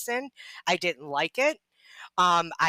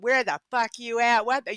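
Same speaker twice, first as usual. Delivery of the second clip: retold angry letter; 5 semitones higher than usual. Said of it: "just crazy".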